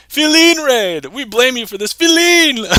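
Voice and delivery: excited raid shout. Real, not acted. Streamer greeting the raiders, loud excited call. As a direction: hype up the raid with a cheer.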